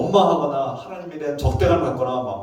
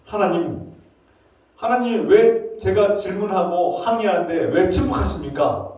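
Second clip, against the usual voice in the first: raised, insistent complaint; protesting; 4 semitones higher, 4 dB louder than usual. Acted. Prophet protests to God about unanswered prayer, insistent. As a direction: plead and protest, building louder and higher. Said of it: "막 항의하고 있어요".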